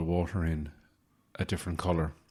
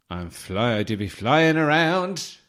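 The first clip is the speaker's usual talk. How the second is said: imitating a friend; 6 semitones higher, 7 dB louder, slower than usual. Quoting Cara in a put-on voice.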